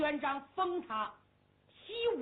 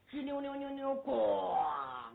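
imitated long creak then a low bang; creaking gate slam; 3 semitones lower, slower than usual. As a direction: gate swinging shut. 寨门关上的声音. (口技模仿寨门吱扭咣当)

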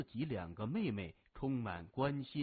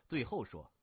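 usual speaking voice

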